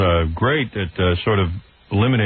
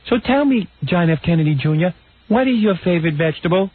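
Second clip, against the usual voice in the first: interviewer impression pitched far above the usual voice; Barbara Walters; 7 semitones higher than usual. Host repeats his mocking interviewer imitation, high-pitched. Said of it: "this is how she talks".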